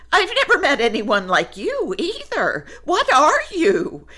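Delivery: high, fast laughing question; bubbling with amused wonder; giggly surprise. Acted. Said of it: "said Woolly".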